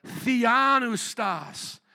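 very slow, stretched "Theanustas"; drawn-out word. Shown as a demonstration. Preacher showing congregation the big Greek word, drawn out. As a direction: draw out every syllable of "Theanustas".

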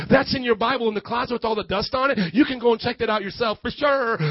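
ordinary delivery

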